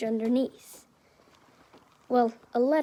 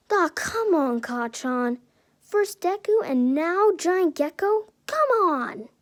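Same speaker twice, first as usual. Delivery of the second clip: higher-pitched exasperated whine; exasperated protest; 5 semitones higher than usual. Acted. Izuku complaining to Kacchan at the giant gecko remark, exasperated.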